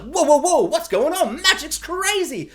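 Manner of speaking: high, slowing exclaim of disbelief; mock amazed muggle. A stunned muggle at magic, high mock amazement. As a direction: play a gobsmacked muggle, drawn out. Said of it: "a muggle that's like".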